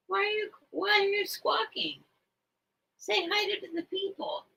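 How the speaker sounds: high soft coaxing; pet talk. Real, not acted. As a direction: coax the bird to greet viewers. Talking to the bird.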